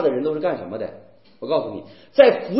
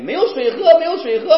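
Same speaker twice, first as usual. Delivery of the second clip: whining crowd; 10 semitones higher, 4 dB louder than usual. Retold or imitated. People complain to Moses.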